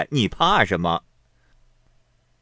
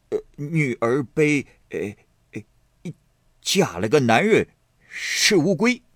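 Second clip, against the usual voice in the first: stammering oaf; 5 semitones higher than usual. A narrator's reading. Xue Pan finally blurting his crude verse, stammering. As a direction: grope for the line, then blurt it high. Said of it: "又咳了两声".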